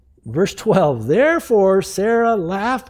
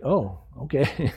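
awkward acceptance; 5 semitones lower, 6 dB quieter than usual. Acted. Abraham backing off awkwardly.

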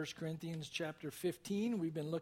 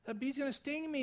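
put-on much higher, frightened little-girl voice; imitated freaking out; 10 semitones higher than usual. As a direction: act out a child in a panic. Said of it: "and she's freaking out".

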